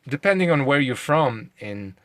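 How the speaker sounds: ordinary talk, nothing marked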